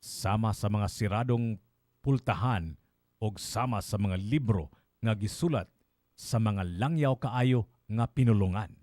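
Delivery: measured Binisaya verse reading, pausing between phrases; solemn poetry reading with spaced phrases; poem recitation. Acted. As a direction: recite it line by line, deliberately. Reads the translated poem.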